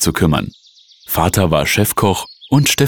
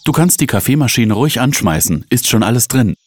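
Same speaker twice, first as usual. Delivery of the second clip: higher-pitched, mildly commanding instruction; bossy order; 6 semitones higher than usual. A narrator's reading. Sister directing brother in the kitchen, mildly bossy. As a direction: order him about lightly. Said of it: "befahl Steffi".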